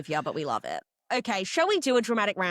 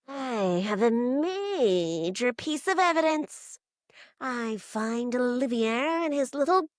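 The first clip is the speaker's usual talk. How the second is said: slow, drawn-out theatrical sleuth; mock-portentous reveal, stretching key words; Illyri, emo; 5 semitones higher, slower than usual. Emo player presenting evidence against Olivier, slow mock drama. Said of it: "Emo, Illyri".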